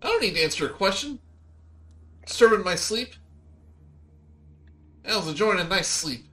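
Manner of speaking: high, complaining squeaky voice; grumpy woken creature. Acted. Meepo grumbles at the party for waking him, high and whiny. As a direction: whine about being woken up.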